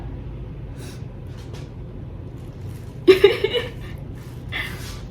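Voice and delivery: breathy high-pitched giggling; high giggle. Real, not acted. Giggling at own joke.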